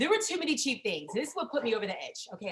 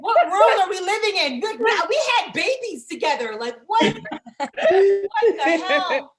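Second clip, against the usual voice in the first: much higher, loud laughing yell; laughing outraged shout; 8 semitones higher, 9 dB louder than usual. Retold or imitated. Comic wife to husband, a laughing outraged shout. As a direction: yell it at him through laughter. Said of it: "I was like".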